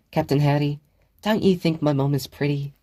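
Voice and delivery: put-on a child coaxing an imaginary friend; sweet girl's question. A narrator's reading. Regan asks the board.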